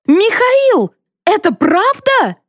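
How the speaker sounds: very high, slowed appeal; outraged disbelief. A narrator's reading. Wife confronting her silent husband, outraged and disbelieving. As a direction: demand the truth in high-pitched shock.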